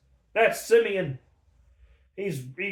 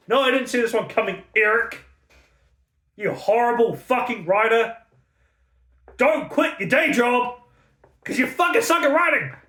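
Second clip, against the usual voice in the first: angry rant; 6 semitones higher, 4 dB louder than usual. Real, not acted. Ranting at the author Eric.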